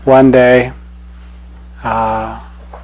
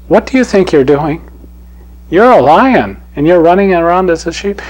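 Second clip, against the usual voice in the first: higher, indignant demand; scolding lion; 5 semitones higher, 4 dB louder than usual. Acted. Proud lion confronting the sheep-like cub, scolding. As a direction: scold the cub for acting like prey. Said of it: "This lion says".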